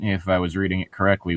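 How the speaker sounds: normal talking voice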